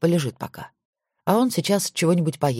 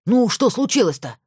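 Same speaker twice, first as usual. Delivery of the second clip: shouting; 7 semitones higher than usual. Acted. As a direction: push it out high and urgent. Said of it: "сразу закричал Макаров".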